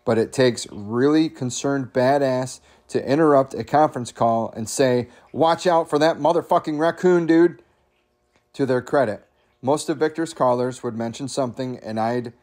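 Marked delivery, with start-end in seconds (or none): 5.3-7.6 raised-pitch warning, louder on the end; urgent warning. Acted. Coworker interrupting a conference call, urgent warning.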